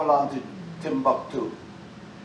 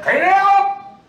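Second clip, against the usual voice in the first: high, loud and drawn out; stretched high mock shout; mock shouted order; 8 dB louder, slower than usual. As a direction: bark the order like a slave driver. Acted overseer shouting order.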